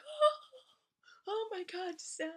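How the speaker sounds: very high, hammed-up shriek; mock agony, squealed at the top of your range; pained scream. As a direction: imitate crying out as the sore spot is pressed. Retold reaction to painful poking.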